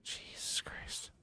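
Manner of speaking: slow breathless whisper of frustration; exasperated whisper. Real, not acted. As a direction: whisper it, fed up. Frustrated gamer, muttered exasperation.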